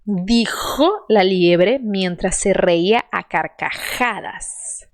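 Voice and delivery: slow Spanish with a strong rasped J; slow model sentence. Shown as a demonstration. Teacher models the sentence again. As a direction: read it slowly, J forceful. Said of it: "vamos lá, de novo".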